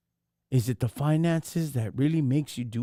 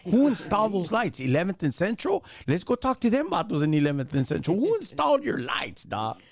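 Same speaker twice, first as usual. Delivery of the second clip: higher, heated street grilling; mock interrogation; 7 semitones higher than usual. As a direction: pepper him with questions, voice up. Role play grilling the agent.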